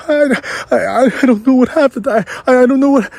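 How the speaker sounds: high, whimpering imitation of a tearful trader; mock crying. Junior trader to partners, mock crying, high and stammering. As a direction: stammer it out like a panicked kid near tears. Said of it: "like, crying".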